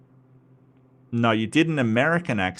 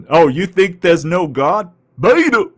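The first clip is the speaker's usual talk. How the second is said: louder, higher mocking yell building to beta; Jesse Lee Peterson rant; 6 semitones higher, 8 dB louder than usual. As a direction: ham up the preacher's scorn. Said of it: "The Jesse Lee Peterson".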